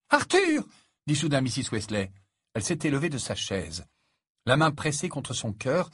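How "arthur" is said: high, loud startled call; sudden cry. A narrator's reading. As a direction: call out to your husband in alarm. Mother suddenly cries out to husband. (cri soudain, aigu et saisi)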